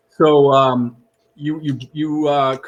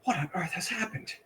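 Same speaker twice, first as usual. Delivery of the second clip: shocked whisper; 8 semitones higher, 9 dB quieter than usual. Acted. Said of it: "he goes".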